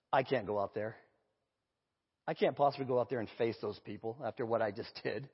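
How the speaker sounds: low, soft retold despair; dejected refusal. Acted refusal of ashamed player.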